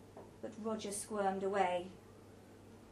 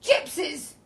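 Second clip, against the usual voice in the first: loud, higher outburst; raised, projecting voice; loud pronouncement; 5 semitones higher, 10 dB louder than usual. A narrator's reading. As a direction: announce it loudly across the table. Roger names the beggars disapprovingly.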